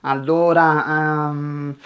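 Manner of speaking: stalling learner, drawn-out sounds; mock hesitation with long level vowels; hesitant filler. Shown as a demonstration. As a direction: stretch allora and um to fill the silence. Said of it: "They fill silence with".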